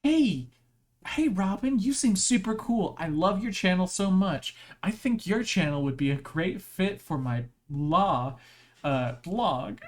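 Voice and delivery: high, loud, gushing fan voice; overeager spammer. Pretend. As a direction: pitch him your blog, too eager. Voicing a spammer in chat.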